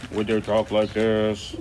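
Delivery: slow, put-on puppet voice; a second character, drawn out slowly; slow made-up voice for a puppet; different character voice. Shown as a demonstration. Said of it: "Think of a voice".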